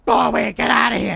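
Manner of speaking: loud high yell to drive them off; shouting warning. Retold or imitated. Cop yelling at attackers to get away, loud shout. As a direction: bark it at the attackers.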